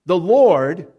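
"the Lord" called out with a lifted finish; proclaiming. Acted. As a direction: announce "the Lord" like a herald. Preacher proclaiming the divine name.

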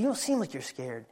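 put-on soft, wondering child; hushed. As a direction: say it quietly in awe. Boy whispering to dad.

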